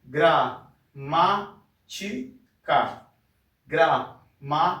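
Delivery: "Gramática" slowly, a pause after each syllable. Shown as a demonstration. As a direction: sound out Gramática in separate beats. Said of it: "quando eu leio a palavra pausadamente".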